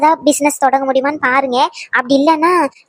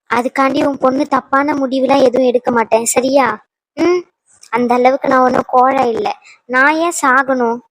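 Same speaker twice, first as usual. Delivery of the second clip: normal talking voice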